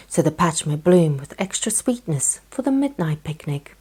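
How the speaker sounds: usual speaking voice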